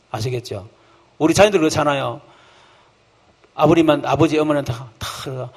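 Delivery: normal talking voice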